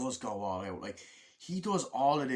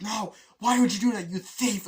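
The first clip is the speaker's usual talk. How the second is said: high, loud indignant accusation; yelling "thief" at the travel partner, hammed-up; mock outrage; 9 semitones higher, 9 dB louder than usual. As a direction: hurl it at him like an outraged kid. Acted accusation in quarrel.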